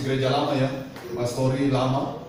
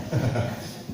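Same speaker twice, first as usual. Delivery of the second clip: soft chuckle; 5 semitones lower, 8 dB quieter than usual. Real, not acted. Joke about rubbing eyes.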